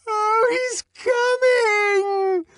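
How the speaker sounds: very high, slow scream; woman screams as the music erupts; frenzied scream. Acted. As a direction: scream it in ecstasy. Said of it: "screamed Clara Detterding".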